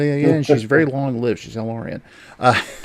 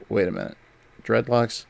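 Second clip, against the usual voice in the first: ordinary talk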